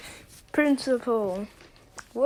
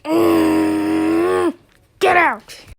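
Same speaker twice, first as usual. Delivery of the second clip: angry shout; 3 semitones higher, 6 dB louder, much slower than usual. Acted. Teacher kicking the student out, shouting. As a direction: throw them out with a yell.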